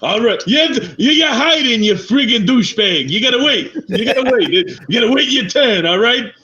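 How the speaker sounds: put-on Slug scolding in a Boston accent. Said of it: "speak in a Boston accent".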